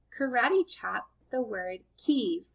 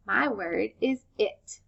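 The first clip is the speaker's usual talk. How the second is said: ordinary delivery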